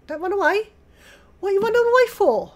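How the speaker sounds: a loud, high, hurried holler; calling out loud. Real, not acted. Gamer yelling at a fleeing dinosaur, high loud call.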